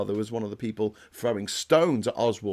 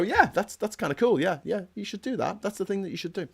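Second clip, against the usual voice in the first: a hint of a pleased grandad, pitched higher; mild mock of a cheerful elder's blessing; approving old-timer; 6 semitones higher than usual. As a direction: lightly voice an old man nodding along approvingly. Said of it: "smile upon and go".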